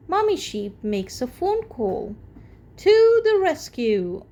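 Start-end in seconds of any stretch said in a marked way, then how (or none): 2.8-4.2 high, loud rally cry; cheerful heroic cry. Acted. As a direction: shout it like heroes setting off. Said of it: "cry Danny and Grandad Dog".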